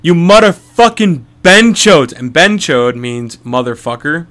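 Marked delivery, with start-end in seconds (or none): 0.0-2.1 loud, higher, drawn-out insult; slow insulting shout. Retold or imitated. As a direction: hurl the slur slowly and loudly at the scammers. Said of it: "And I was like".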